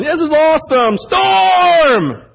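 hammed-up moan about getting wet; mock complaining yell. Preacher acts out complaining in storm.

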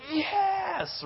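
high, soft squeal of joy; a thrilled little yes breaking out high; inner joy. Acted.

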